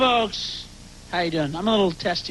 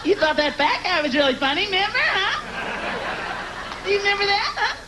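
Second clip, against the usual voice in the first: high, nagging mock wife voice; sarcastic wife; 10 semitones higher than usual. Wife in bedroom needling husband about the comic, high nagging sarcasm. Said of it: "she's gonna go".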